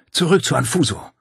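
high, urgent hissed command; hissed order. A narrator's reading. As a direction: hiss it sharply, pitched well up. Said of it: "Sie zischte". (scharf gezischter Befehl)

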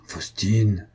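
understated, drawn-out name with reproach; murmured reproach. A narrator's reading. Mario gently reproaching Faustine, murmured reproach. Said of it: "murmurai-je d'un ton de reproche".

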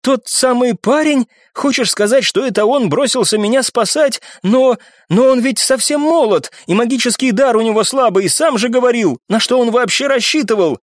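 high-pitched, agitated disbelief; amazed. A narrator's reading. Оксана learning who went to save her, amazed. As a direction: question it in astonishment. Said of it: "изумлённо переспросила Оксана".

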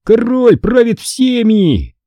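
slow, high, proud proclamation; triumphant cry. A narrator's reading. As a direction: crow it like a victorious king. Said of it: "и закричал".

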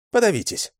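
high spiteful jab; spiteful retort. A narrator's reading. Lyusya throws her rifle to the man, high and spiteful. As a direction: spit it out in contempt.

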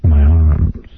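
low, slow romantic croon; seductive voice. Acted. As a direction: pour on the fake romance. [name] playing seductive lover.

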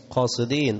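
usual speaking voice

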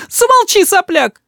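very high, exasperated rebuke; angry cry. A narrator's reading. Mother silences her cheeky son, angry. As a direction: shut him up with a high cry. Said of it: "кричит мама".

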